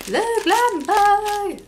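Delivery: high, loud, stretched-out squeal; excited announcement. Real, not acted. Vlogger announcing the glam bag has arrived, thrilled. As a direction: draw each word out high in triumph.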